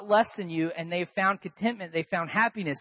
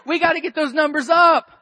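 mock panic; 4 semitones higher, 7 dB louder than usual. Mock panic over attendance.

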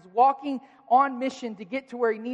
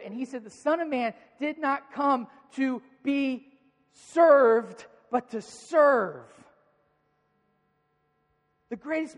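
preaching higher and louder, building fervour; impassioned preaching; 5 semitones higher, 4 dB louder than usual. Acted. Pastor urges the church toward service, full fervent preaching. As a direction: drive it home to the congregation.

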